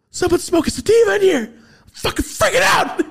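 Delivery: high, loud mock-panicked shriek; hammed-up friend in a frantic tizzy; imitating frantic freak-out. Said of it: "like a bitch that can't find her pills".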